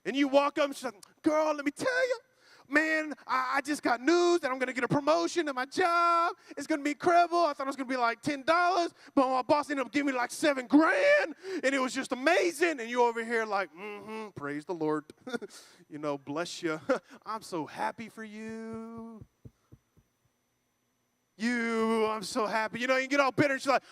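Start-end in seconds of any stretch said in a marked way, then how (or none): 1.2-12.8 put-on higher, excited bragging girlfriend act; gushing friend. Voices friend gushing good news. 13.7-15.0 low, subdued reply through envy; begrudging praise. Acted. Bitter listener feigning joy for friend. 16.2-16.8 begrudging blessing. Acted. Bitter listener feigning joy for friend. 17.2-22.8 soft, grudging congratulations, drawn out; feigned happiness. Acted. Bitter listener feigning joy for friend.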